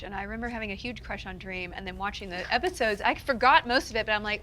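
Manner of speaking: normal talking voice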